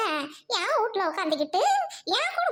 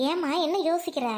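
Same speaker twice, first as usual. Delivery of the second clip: ordinary delivery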